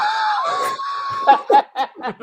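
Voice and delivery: big squealing laugh; high shrieking laugh. Real, not acted. Laughs after his story.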